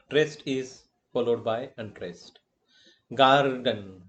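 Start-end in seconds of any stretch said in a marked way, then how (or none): 3.1-4.0 "Garden", first syllable punched and drawn out; stress on first syllable. Shown as a demonstration. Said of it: "Gar is a traced syllable".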